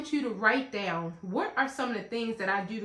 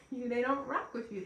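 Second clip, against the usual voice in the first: ordinary talk, nothing marked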